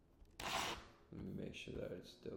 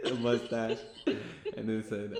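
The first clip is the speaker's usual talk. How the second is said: laughing while describing; 11 dB louder than usual. Real, not acted. Describing funny emoji, laughing.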